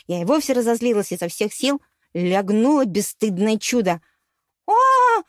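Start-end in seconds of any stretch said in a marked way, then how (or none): 4.7-5.2 sharp high yelp, kicked; wheezy cry of pain. A narrator's reading. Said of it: "просипела Юда голосом Ива".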